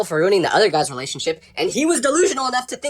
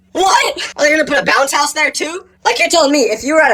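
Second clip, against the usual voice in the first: higher, thrilled outburst; excited disbelief; 7 semitones higher, 3 dB louder than usual. Real, not acted. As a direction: burst out in delight. Excited about funeral fountain.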